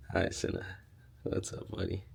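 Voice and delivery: soft pet talk. Real, not acted. Greeting the cuddling dog.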